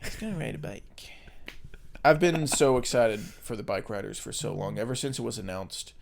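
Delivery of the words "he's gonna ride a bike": very soft character voice; put-on quote voice. Host echoes co-host's bike line, soft put-on voice. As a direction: echo the line softly, in character.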